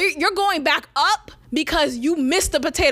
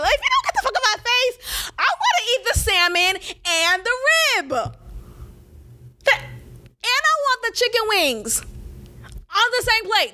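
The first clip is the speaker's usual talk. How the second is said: mock defiant rant; 8 semitones higher than usual. Real, not acted.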